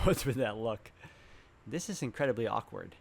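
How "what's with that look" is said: laughing through the question; amused, laugh then ask. Real, not acted. Laughing at awkward caught scene.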